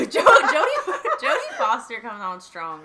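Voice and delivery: high, loud words broken up by laughter; bursting out laughing. Real, not acted. Friend teased about sounding like Jodie Foster, bursting out laughing. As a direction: crack up while trying to speak.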